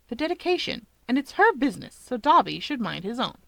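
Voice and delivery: ordinary delivery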